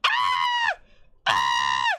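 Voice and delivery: teasing mimic of brother screaming her name; mock panicked screams. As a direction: imitate him shrieking in panic. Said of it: "Antonio would be like".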